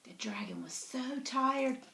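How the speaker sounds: quick hushed whisper. A narrator's reading. Narrator whispering to the children.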